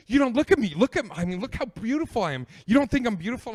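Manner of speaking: high, loud and pressing; intense woman. Retold or imitated. Date demanding he look at her, intense high voice.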